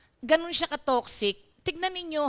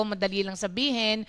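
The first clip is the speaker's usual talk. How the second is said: ordinary delivery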